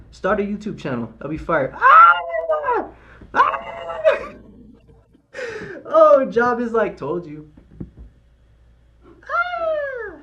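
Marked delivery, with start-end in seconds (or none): 1.8-4.3 sudden scream, much higher and louder; screaming at the price move; excited scream. Real, not acted. Reacting to a trade live. 9.2-10.2 screaming at the price move; excited scream. Real, not acted. Reacting to a trade live.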